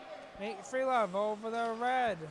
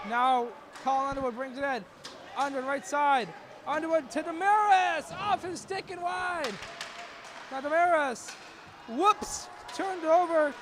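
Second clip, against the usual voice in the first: play-by-play building, higher and louder; excited call; 4 semitones higher, 4 dB louder than usual. Real, not acted. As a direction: lift the voice as the attack builds.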